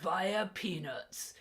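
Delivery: slow booming wrestling promo; wrestler voice. Pretend. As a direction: drag it out like a pro wrestler. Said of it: "Why am I going into, like, wrestler voice?".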